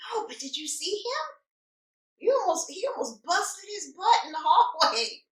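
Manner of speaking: high-pitched, insistent mom voice; imitating excited mom. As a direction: play mom who won't drop the gossip. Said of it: "my mom doesn't know how to whisper".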